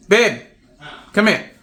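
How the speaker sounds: loud, high call to another room; calling babe from across the house. Real, not acted. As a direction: shout for him to come quick.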